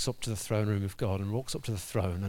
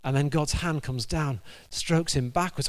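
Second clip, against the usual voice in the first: ordinary talk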